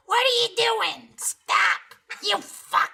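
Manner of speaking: very high shrieking mother voice; Denise voice. Retold or imitated. Son imitating his drunk mother scolding him, very high shriek. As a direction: shriek it like an outraged tipsy mom. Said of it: "our Denise voice is like".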